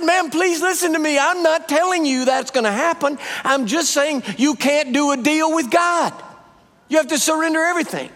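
very high, urgent retold plea; insistent pleading. Preacher retelling his plea to the moaning woman, insistent.

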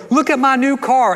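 high-pitched proud announcement; acted thrill, pitched well up; excited showing off. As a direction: burst out with new-car pride. Acting a proud new car owner.

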